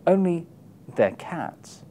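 ordinary talk

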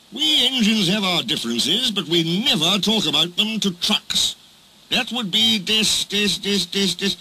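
high-pitched indignant protest breaking into stutters; indignant stammer. Acted. Engine defends engines' honour to Diesel, indignant and stammering. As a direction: protest pompously, then stick on the syllable.

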